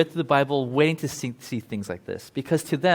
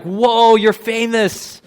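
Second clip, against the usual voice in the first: louder and higher, gushing starstruck wonder; mock starstruck awe; 6 semitones higher, 7 dB louder than usual. Friends gush at a famous athlete's relative, hammed-up starstruck awe. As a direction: gape at a famous relative, overdone.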